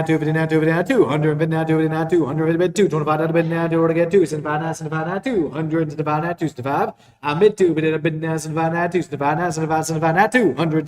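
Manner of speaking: rapid rolling bid chant; auctioneer. Acted. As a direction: rattle off the bids like an auctioneer.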